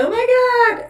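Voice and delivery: mock horror at the lipstick; mock shocked gasp. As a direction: play the aghast viewer, pitch shooting up. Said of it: "everybody's gonna be like".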